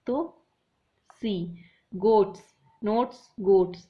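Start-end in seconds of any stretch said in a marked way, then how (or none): 1.9-3.8 goats and notes said louder, ending oats stressed; loud rhyme words. Shown as a demonstration. Teacher to class, matching rhyming endings loudly. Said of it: "same लग रहा है".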